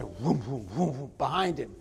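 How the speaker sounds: low, soft whooshing saloon doors; imitated swinging doors. Preacher mimics saloon doors behind the gunslinger, low whooshing.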